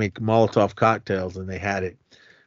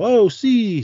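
high, loud imitated holler; calling out; 12 semitones higher, 5 dB louder than usual. Mario calling to C down the street, loud high call. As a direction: imitate hailing a friend, pitch leaping up.

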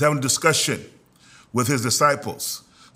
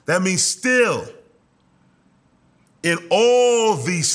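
higher-pitched, stretched key words, triumphant delivery; impassioned preaching; 5 semitones higher than usual. Real, not acted. Preacher assures the congregation they are conquerors, impassioned preaching. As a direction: preach it with rising fervor, leaning hard on big words.

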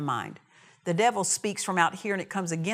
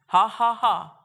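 defiant laugh; 2 semitones higher, 7 dB louder, slower than usual. Retold or imitated. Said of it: "So he's sitting there".

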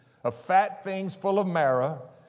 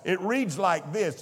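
ordinary delivery

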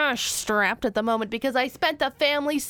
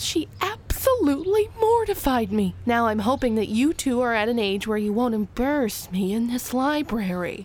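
ordinary talk, nothing marked

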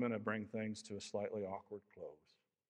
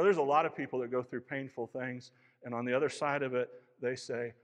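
ordinary talk, nothing marked

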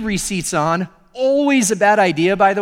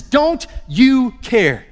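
higher, louder, very slow on "Don't you care"; frustrated demand; 5 semitones higher, 4 dB louder, slower than usual. Acted. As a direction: stretch it out in frustration. Martha walks in, demands.

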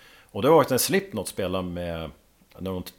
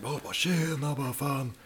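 just woken up; 5 semitones higher, 5 dB quieter than usual. Retold or imitated. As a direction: play someone barely awake, dragging the words. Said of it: "bara yrvaken".

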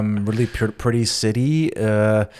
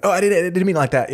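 fast, high, flustered backpedal; hurried mock panic; flustered apology; 8 semitones higher, faster than usual. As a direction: rush to explain it away. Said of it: "and you're like".

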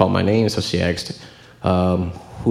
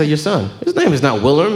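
put-on dad correcting in a light Haitian accent, pitched higher; 8 semitones higher, 4 dB louder than usual. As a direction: insist on "Willem" with a Haitian accent, high and firm. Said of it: "of course, with the accent".

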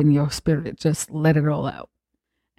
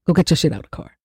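ordinary delivery

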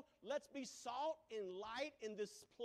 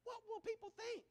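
voice of the flesh; 11 semitones higher than usual. Acted. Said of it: "But my flesh said".

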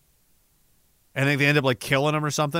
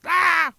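a sudden high mock shriek; mock goblin scream; 13 semitones higher than usual. Mocking goblins to chat with a high shriek. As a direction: screech like a goblin. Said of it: "They scream everywhere they go".

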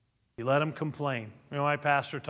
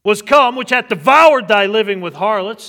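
very loud, high, accusing; bitter elder brother; 8 semitones higher, 16 dB louder than usual. Acted. Elder brother accusing his father, loud and bitter. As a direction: throw the harlots in his face. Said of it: "you can hear the bitterness in the elder brother's".

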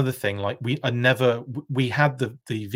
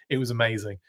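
ordinary delivery

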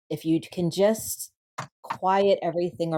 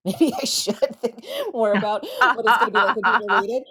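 laughing; 6 semitones higher, 4 dB louder, faster than usual. Real, not acted. Laughing at her own admission.

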